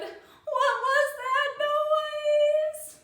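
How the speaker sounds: put-on high, slow, loud scared-child wail; frightened wail. Little girl asks grandma about the noise, frightened wail.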